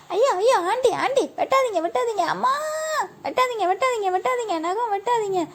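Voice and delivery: put-on high, loud child's wail of protest; panicked screaming. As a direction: shriek and beg them to stop. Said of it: "இந்த கத்து கத்துற".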